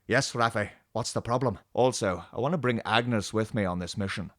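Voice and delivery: lightly voiced starship captain, low and subdued; Picard. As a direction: answer a nagging crewmate with restraint. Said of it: "about everything Picard says and does".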